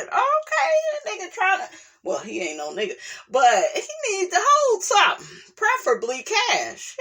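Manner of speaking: laughing so hard it squeaks; high-pitched laughing rant. Real, not acted. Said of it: "laughing rant".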